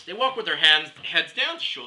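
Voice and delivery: ordinary talk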